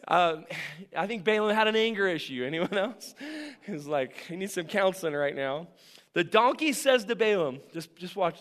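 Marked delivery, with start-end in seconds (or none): none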